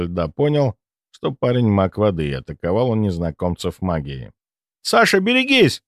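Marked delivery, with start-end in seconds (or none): 4.8-5.8 high shouted warning. Acted. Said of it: "выкрикнул Сеня".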